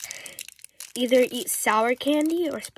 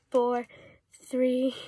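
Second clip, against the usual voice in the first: ordinary talk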